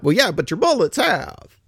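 normal talking voice

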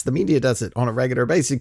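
ordinary delivery

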